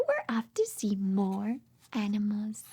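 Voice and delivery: hushed storybook whisper. Acted. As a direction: whisper it as a secret plan. Whispering while reading to toddlers.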